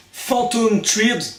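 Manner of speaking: Phantom Thread said slowly and high in a hammed-up English accent. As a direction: put on an exaggerated English accent for the title. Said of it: "Désolé, mon accent anglais est pourri". (accent anglais exagéré, lent et aigu)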